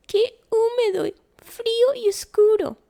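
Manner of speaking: very high, loud little mouse squeak; squeaky mouse. A narrator's reading. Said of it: "dice el ratón mientras chilla".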